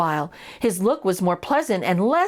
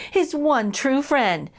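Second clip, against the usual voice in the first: ordinary delivery, nothing marked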